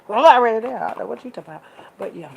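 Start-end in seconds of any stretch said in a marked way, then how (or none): 0.1-0.8 already belted out much louder and higher; loud exclaim. Real, not acted. Telling viewers she is ready for Heaven, loud joyful exclaim. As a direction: shout it out with joy.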